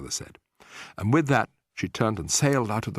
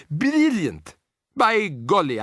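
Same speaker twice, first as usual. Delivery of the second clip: high, loud joyous cry; delighted; 9 semitones higher, 5 dB louder, much slower than usual. A narrator's reading. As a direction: cheer the idea. Grandmother delighted.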